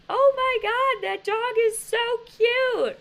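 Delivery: high-pitched, drawn-out gushing yell; yelling from afar. Retold or imitated. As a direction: holler it across a park, high and loud. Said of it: "stops putting out the sign to yell to me".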